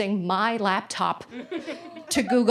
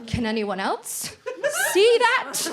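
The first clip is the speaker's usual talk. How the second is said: mortified innocence; 11 semitones higher, slower than usual. Retold or imitated. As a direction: panic creeping in as you ask. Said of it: "I was like".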